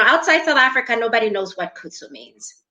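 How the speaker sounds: ordinary talk, nothing marked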